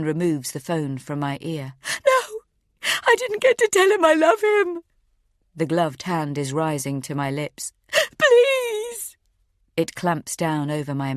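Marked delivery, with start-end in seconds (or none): 2.1-4.8 very high, loud desperate shriek; mother begging as the phone is taken away; anguished scream. Acted. Phone taken from her ear. 8.2-9.2 very high, loud begging cry; mother pleading with her captors; desperate plea. Acted. Hand about to gag her.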